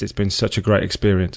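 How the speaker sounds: ordinary delivery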